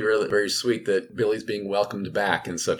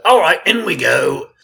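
higher, louder Cockney zombie call; cheery Cockney undead; [name]'s Cockney voice; 9 semitones higher, 11 dB louder than usual. Acted. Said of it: "That's the same voice".

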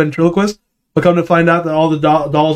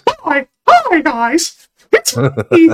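much higher falsetto cartoon mouse greeting; Mickey Mouse voice; 12 semitones higher, much slower than usual. Showing a friend his Mickey Mouse, high falsetto. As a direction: squeak it out like Mickey. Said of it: "so I got Mickey Mouse".

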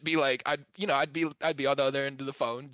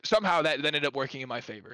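usual speaking voice

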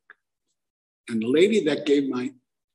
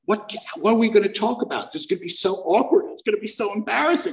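higher, fast nervous worrying; anxious rambling; 8 semitones higher, faster than usual. Retold or imitated. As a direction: panic about small talk, rushing. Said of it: "I was like".

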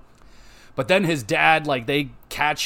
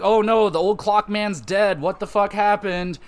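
mock shock; 6 semitones higher than usual.

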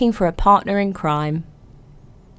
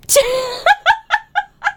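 laugh. Real, not acted. Laughing at listener submission.

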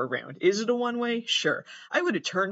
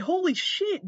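a sharp jump up in pitch on "Holy"; stunned exclamation. Real, not acted. Vlogger stunned by a crash clip, high-pitched holy shit. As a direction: caught off guard by the impact.

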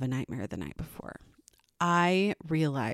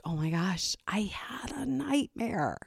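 put-on breathy little-girl whisper; soft, slow whispered child; whispered kid voice; 3 semitones higher, 5 dB quieter than usual. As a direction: whisper like a kid faking fear. Daughter's fake nightmare claim.

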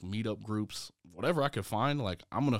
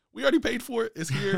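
much higher and louder retort; exasperated protest; 13 semitones higher, 8 dB louder than usual. Retold or imitated. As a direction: protest loudly, pitched way up, retelling a squabble. Retold squabble with wife over waste.

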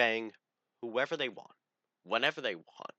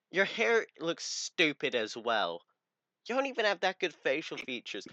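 mock snooty girl; 6 semitones higher than usual. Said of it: "straight up going".

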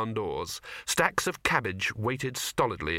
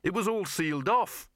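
higher, mildly raised protest; insist, wanting it to be true; said loudly; 8 semitones higher than usual. A narrator's reading. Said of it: "saying it loudly in the hope".

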